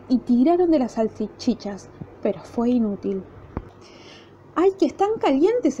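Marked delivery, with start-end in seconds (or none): 4.5-5.8 pained cry. A narrator's reading. Federico cries out at the hot sausages, yelping. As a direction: yelp of pain, higher. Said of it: "exclamó".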